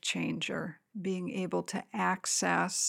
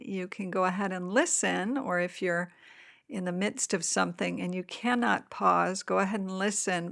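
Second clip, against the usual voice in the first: usual speaking voice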